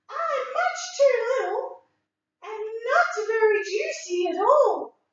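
high, small billy goat voice; a tiny nervous goat; little goat voice. Acted. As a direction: squeak it out like the tiniest goat.

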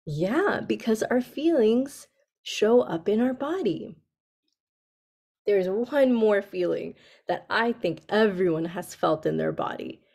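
normal talking voice

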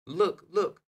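put-on high, loud child's shriek; excited girl shrieking "Look, look!". A narrator's reading. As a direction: squeal it out as the monkey darts past. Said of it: "and Tina shrieked".

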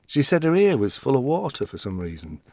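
normal talking voice

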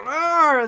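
short gruff arr, imitating; mock pirate arr. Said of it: "when he says".

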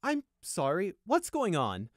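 high, loud bewildered question; interrupt, politely lost; breaking in. A narrator's reading. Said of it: "Cedar Fields broke in".

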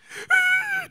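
high, loud imitated wail; mock crying wail. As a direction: fake a manipulative crying wail.

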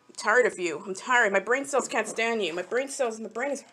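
ordinary talk

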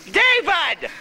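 calling out. Real, not acted. Calling a child sharply.

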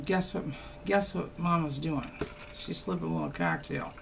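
hushed and playful, letting viewers in on a secret; secretive hush. Pretend. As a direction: lean in and confide it quietly. Confides sneaking a cocktail.

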